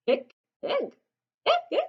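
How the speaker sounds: mock hiccups, last ones high; hiccupping. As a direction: hic it out. Papa Bear's hiccups.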